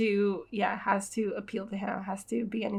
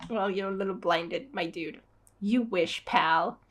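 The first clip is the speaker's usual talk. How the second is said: normal talking voice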